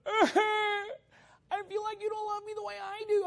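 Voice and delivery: high, slow, crying mock plea; mock whiny plea. Preacher mocking a movie girlfriend, high whiny cry. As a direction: whine it like a clingy movie girlfriend.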